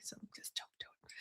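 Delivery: hushed, fast conspiratorial whisper; a sudden joking stage whisper. Real, not acted. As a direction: whisper it like a secret aside. Joking aside about a town meeting.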